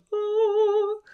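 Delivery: a high, swooning oh of delight; dreamy gasp of pleasure at opening the box; blissful oh. Retold or imitated. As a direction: sigh it out like unboxing treasure. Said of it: "the lifting of the green box is like".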